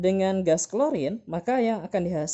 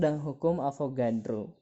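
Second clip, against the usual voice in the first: ordinary talk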